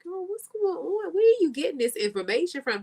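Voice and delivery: high, incredulous questioning; mock disbelief. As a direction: demand where she heard that. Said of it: "I was like".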